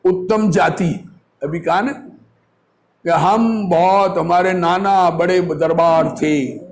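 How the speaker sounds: usual speaking voice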